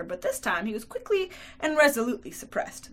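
ordinary delivery, nothing marked

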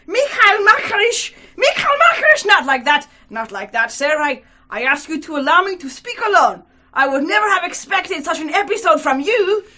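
loud, high, scolding objection; crying out in protest. Acted. Young attorney tries to stop the old commissioner, loud indignant protest. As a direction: call him to order loudly. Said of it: "cried the short young man".

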